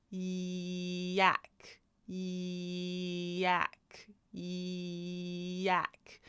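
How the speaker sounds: "yak" begun on a long, level yee; stretched Y sound. Shown as a demonstration. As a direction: hold "yeee", then drop into "yak". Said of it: "So the yee part".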